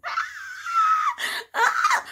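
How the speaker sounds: high, short shrieks; re-enacted screams of pain; screaming. Showing the guys how she screamed, high mock shrieks. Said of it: "guys, I was screaming".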